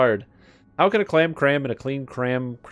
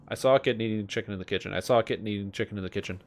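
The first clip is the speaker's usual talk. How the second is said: quick, soft rattle; said fast; 4 semitones lower, 6 dB quieter, faster than usual. Shown as a demonstration. Streamer testing the kitten twister at speed. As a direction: speed through it twice. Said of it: "that one's not hard".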